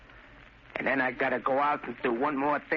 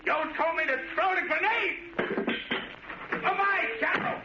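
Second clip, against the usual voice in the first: high, wild voice, unhinged; crazed; 12 semitones higher than usual. Acted. Danny threatening the Shadow with a grenade, high and crazed. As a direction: sound like he's lost his mind.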